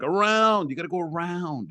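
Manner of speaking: high pitch, stretched on "around"; exasperated, exaggerated direction-giving; insistent directing, retold. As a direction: re-enact pleading with a confused driver. Repeating direction to confused driver.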